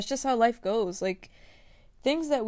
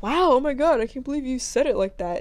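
mock amazement; 3 semitones higher, 7 dB louder than usual. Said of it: "They'd be like".

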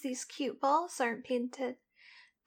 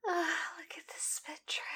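whispering; 3 semitones higher, 4 dB quieter than usual. Acted. Sensual whispered roleplay.

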